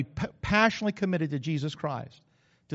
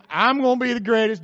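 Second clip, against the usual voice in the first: loud bragging boast; boastful James; 5 semitones higher, 7 dB louder than usual. Retold or imitated. James brags to the other disciples, loud and boastful. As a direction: brag it out loud like a rival.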